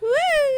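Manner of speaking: high squealing laugh and whoop; shrieking laugh. Real, not acted. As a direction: let out a piercing excited shriek. Wild reaction during game.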